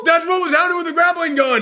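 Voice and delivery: high-pitched, fast excited outburst; excited realization. Real, not acted. Podcaster capping co-host's arrow joke, excited. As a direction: blurt out the joke's answer.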